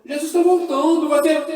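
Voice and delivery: loud, high preaching at full force; fiery preaching. Real, not acted. Preacher shouting the truth to the church. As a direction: proclaim it to the congregation.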